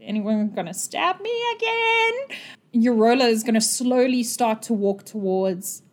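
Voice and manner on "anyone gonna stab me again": tentative, rising to a high pitch; awkward silence. Acted. Game master voicing the wait after a fight, awkward. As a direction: creep up high into the awkward hush. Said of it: "There's that awkward silence of".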